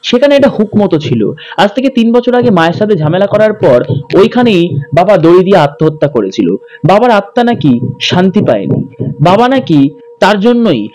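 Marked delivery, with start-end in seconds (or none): none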